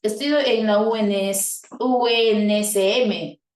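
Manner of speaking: "U N S M" deletreado despacio, letra a letra; letra por letra. Shown as a demonstration. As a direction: deletrea "U N S M" pausando cada letra. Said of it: "no podemos decir". (sigla leída letra por letra, lento)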